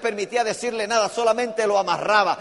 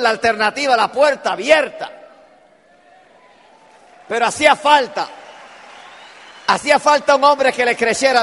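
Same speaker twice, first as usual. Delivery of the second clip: preaching at full force; 3 semitones higher, 7 dB louder than usual. Acted. Preacher having the congregation repeat the blessing, shouting. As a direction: shout the promise to the whole room.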